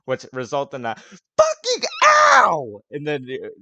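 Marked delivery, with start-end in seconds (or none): 1.4-2.8 huge high drawn-out yell of pain; pained yelp. Acted. Fingers slammed in the door, a loud pained howl. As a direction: howl as the fingers get crushed.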